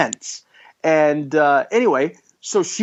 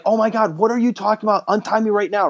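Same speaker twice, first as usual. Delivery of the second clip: higher, frantic plea to be untied right now; panicked plea; 5 semitones higher, faster than usual. Retold or imitated. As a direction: beg him in alarm. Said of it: "And she's like".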